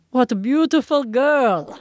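slow, lightly put-on Eastern European accent; a hint of an Eastern European nurse, drawn out. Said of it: "I'm gonna do a horrible accent".

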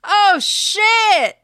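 shocked high yelp; startled exclamation. Real, not acted. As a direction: blurt it out in shock. Startled by a gum shot.

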